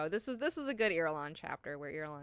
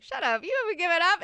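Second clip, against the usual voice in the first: loud, high, fast mock outburst; heated retort; 11 semitones higher, 8 dB louder, faster than usual. Ghanima cuts Irulan off, heated retort. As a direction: shout down the other person in disbelief. Acted quarrel, Ghanima snaps back.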